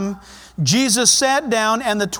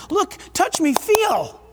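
earnest teacher; 7 semitones higher, slower than usual. Acted. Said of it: "teacher's on his knees trying to communicate".

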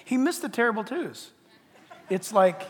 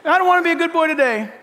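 higher, loud, quick; defiant toddler; 9 semitones higher, 10 dB louder, faster than usual. Retold or imitated. Grandson declaring he won't be good, loud and quick. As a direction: shout it like a defiant boy. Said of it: "toddler retold again".

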